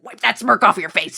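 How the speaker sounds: Southern lawyer scolding. Retold or imitated. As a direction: bark it like an overdramatic Southern attorney. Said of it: "He even says".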